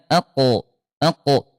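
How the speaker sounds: "ako" with a bounced qaf at the end; "ako" with a bouncing qalqalah. Shown as a demonstration. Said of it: "qalqalah mantul".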